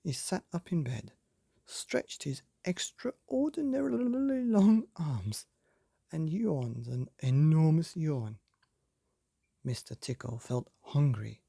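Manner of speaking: ordinary delivery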